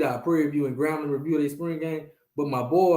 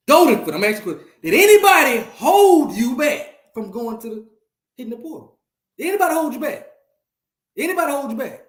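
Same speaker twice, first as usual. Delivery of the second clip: loud grilling; 8 semitones higher, 8 dB louder than usual. Real, not acted. Host grilling Goldenfoot about the transfer portal, loud and high. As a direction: press him hard for an answer.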